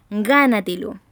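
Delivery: "gánatelo" with GÁ hit loud; stressed first syllable. Shown as a demonstration. Teacher giving a second example, strong stress on GÁ in "gánatelo". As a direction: punch the GÁ of "gánatelo", let the rest drop. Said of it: "se acentúan en la sílaba anterior".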